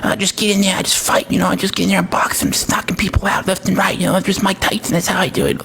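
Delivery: put-on high-voiced fighter, casual chatter; Mike Tyson. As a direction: mumble modestly in a high lisp like an interviewed champ. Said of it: "he goes in for an interview".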